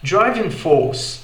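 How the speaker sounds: slow British "driving force", no R; British English. Shown as a demonstration. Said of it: "Já no inglês britânico soaria como". ("driving force" britânico, sem R)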